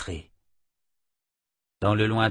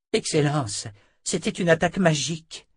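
higher-pitched woman's voice; nun declaring; 8 semitones higher, 3 dB louder than usual. A narrator's reading. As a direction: declare it urgently to the emperor. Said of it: "déclara-t-elle". (sœur qui déclare, voix aiguë)

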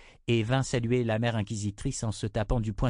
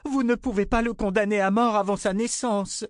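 high, urgent plea; desperate cry; 12 semitones higher, 4 dB louder than usual. A narrator's reading. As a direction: beg for the unborn child's life. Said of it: "s'écria-t-elle". (cri de supplication, voix haute)

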